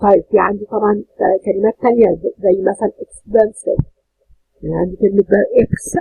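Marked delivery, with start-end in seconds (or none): 5.6-6.0 "إكس" cut off as a syllable by itself; isolated syllable. Shown as a demonstration. Teacher showing the first syllable, "إكس" said on its own. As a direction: say only "إكس", then stop. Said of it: "بس أدي مقطع".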